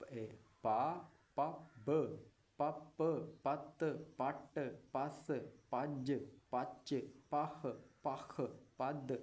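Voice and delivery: slow, even recitation of each sound; teacher chanting the alphabet sounds; syllable drill. Shown as a demonstration. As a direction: sound out every syllable clearly for pupils. Teacher drills Urdu syllables aloud.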